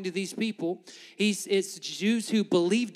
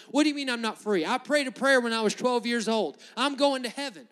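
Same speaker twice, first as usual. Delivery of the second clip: indignant objection, pitched up; mock resistance; 6 semitones higher, 4 dB louder than usual. Preacher acting out a resistant church member, high defensive protest. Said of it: "be resistance going".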